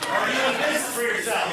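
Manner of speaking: loud, high challenge to the room; rallying call. Real, not acted. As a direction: call it out to get a big yes from the crowd. Repeats question to rally the room.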